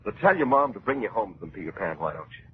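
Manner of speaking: ordinary talk, nothing marked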